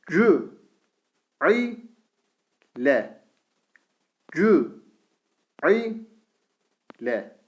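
Vü said with thin Ü, each syllable drawn out; syllable drill with thin vowel. Shown as a demonstration. Teacher reads the thin-vowel syllables to students, slowly. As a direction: read out each syllable apart. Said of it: "Ü sesi".

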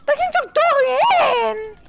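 high shocked yell. Real, not acted. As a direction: cry out in disbelief. Shot went through him.

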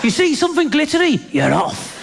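high-pitched indignant rant; hammed-up boyfriend losing his temper; comic angry shouting. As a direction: blow your top at a tearful girlfriend. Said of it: "start shouting".

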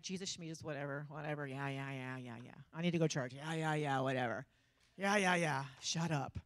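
low, dismissive mumble of scorn; mocking. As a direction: brush it off like a scoffer.

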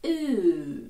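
«ю» as a long drawn-out oo, like room; long vowel sound. Shown as a demonstration. Teacher models the vowel in room, long vowel sound. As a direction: hold «ю» as in room. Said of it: "як в слові room".

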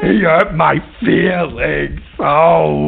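mock crying. [name] cries to host.

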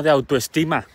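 usual speaking voice

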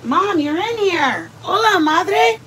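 high, bright hello to mom; excited greeting. Real, not acted. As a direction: greet her with a lift. Greeting her mother on live.

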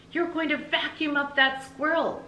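high, called out through the window; shouted warning. Acted. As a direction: warn her across the yard. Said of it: "she shouted".

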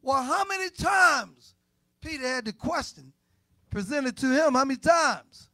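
shouted sermon question; preaching shout. Acted. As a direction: call the question out to the congregation. Preaching at full force.

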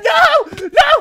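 shrieked no; high scream of despair. Real, not acted. Scream as something dies.

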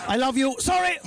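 high, awkward declaration and yelped apology; apologetic Englishman. Acted. Englishman confesses his love, high and apologetic. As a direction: blurt it, then apologise high-pitched. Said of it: "an Englishman confessing his love".

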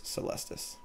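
Celestis breathed out as a soft whisper. Real, not acted. Muttering the card name repeatedly.

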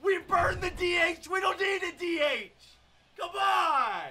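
high, exasperated shouting; frustrated yelling. Real, not acted. Gamer ranting about the lineup, exasperated shout. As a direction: vent at the game.

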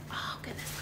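gasp, then a breathless whispered goodness; awed gasp. Real, not acted. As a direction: whisper in amazement. IPad screen lights up.

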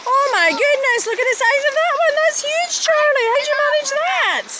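amazed, high praise for the dog; excited praise. Real, not acted. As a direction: gush over the dog's catch. Praising the dog's huge stone.

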